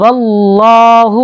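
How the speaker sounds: "واللہ" as one word, V straight into the long heavy L; joined to the vav. Shown as a demonstration. Teacher joining Allah to vav, "واللہ" chanted as one word. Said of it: "اللہ کو واو کے ساتھ ملائیں گے".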